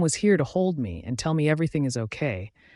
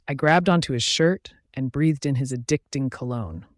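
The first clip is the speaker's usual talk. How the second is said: ordinary delivery, nothing marked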